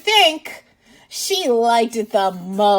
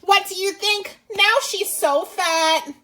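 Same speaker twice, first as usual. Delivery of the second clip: lively, louder read-aloud voice; reading to children; 3 semitones higher, 6 dB louder than usual. Acted. Teacher reading a picture book to kids, lively and loud. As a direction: read it out big for little listeners.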